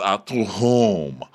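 slow, low drawn-out query; imitating disdain. Diana challenging Sutton's claim, low slow disdain. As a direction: drag it out low and dismissive. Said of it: "And Diana says".

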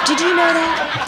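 high laughing repeat; giggling at her own joke. Real, not acted. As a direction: laugh through the words, delighted.

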